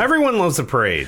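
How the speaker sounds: sing-song. Acted. Cheery exclamation, high and far.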